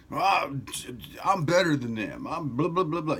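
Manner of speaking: mock the swaggering ego; prideful ego. Imitating the proud ego pumping itself up, hammed-up boast. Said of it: "You call it pride".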